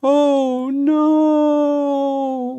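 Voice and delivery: high drawn-out ghostly wail. Acted. Ghost hears the witches' plan, wailing. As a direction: moan it like a ghost. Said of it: "wailed Ghost".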